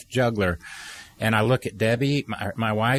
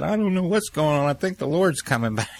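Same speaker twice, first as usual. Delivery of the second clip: high, keyed-up answer easing down; excited alarm; 6 semitones higher than usual. Retold or imitated. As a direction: blurt it out, pitched high, then settle. Answers wife amid the storm.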